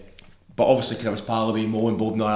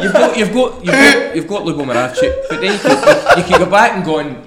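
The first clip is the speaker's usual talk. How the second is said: real laughter breaking up the words, high and loud; laughing while talking; 7 semitones higher, 9 dB louder than usual. Real, not acted. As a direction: struggle to get it out through laughter. Cracking up while answering.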